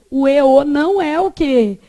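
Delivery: ordinary talk, nothing marked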